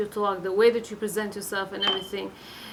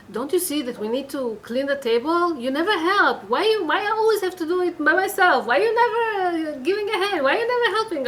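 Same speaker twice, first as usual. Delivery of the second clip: acted exasperated wife, high and loud; mock spousal nagging, increasingly high-pitched; 7 semitones higher, 4 dB louder than usual. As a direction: pile up the complaints, pitch climbing. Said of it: "this kind of a tone".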